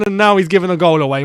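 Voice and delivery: ordinary delivery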